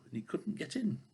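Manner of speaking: ordinary delivery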